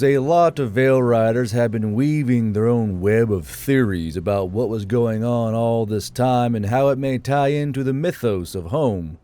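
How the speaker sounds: plain reading voice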